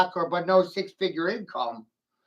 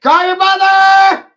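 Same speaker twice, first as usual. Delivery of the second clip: much higher and louder, slow full-throated shout; yelled command; 16 dB louder than usual. Real, not acted. Ranting at a critic, a loud slow yell. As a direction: bellow it across the room.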